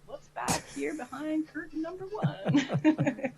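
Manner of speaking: high, drawn-out game-show reveal; hammed-up curtain-reveal voice, breaking into a laugh; playful presenter. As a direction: slowly build up the big reveal, pitched high. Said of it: "I can just be like".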